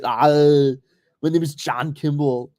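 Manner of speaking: put-on a heavy Austrian accent, the "Ah" pushed out loud; an Austrian strongman stuck with an American name; Schwarzenegger Austrian accent. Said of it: "this, like, fucking six five Austrian".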